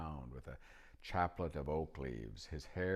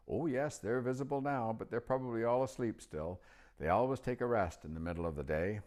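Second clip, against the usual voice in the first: put-on higher, louder old wizard voice; old magician; 6 semitones higher, 4 dB louder than usual. A narrator's reading. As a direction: pitch up into the chatty old magician. Narrator voicing old magician.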